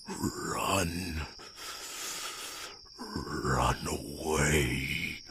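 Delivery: hurt, weakened dragon. Acted. Injured dragon urging its human to run, weak and pleading. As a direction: plead faintly, barely able to speak.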